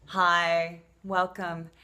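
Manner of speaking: usual speaking voice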